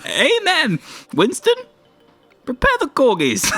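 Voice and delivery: high, comic royal voice; the Queen. Pretend. Pretend Queen giving orders to Winston, high mock-royal voice. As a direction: summon a servant in a high mock-regal falsetto. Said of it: "She's like".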